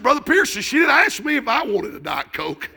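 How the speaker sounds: loud, high comic protest; hammed-up indignant retort; mock outrage. Retelling mock outrage at waitress.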